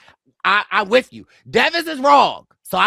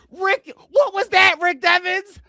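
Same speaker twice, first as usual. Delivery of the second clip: excited outburst; 8 semitones higher, 7 dB louder than usual. Real, not acted. Host reacting to clip.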